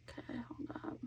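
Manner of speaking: soft unvoiced hush; whisper. Real, not acted. Whispering to herself mid-painting. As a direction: pause yourself in a whisper.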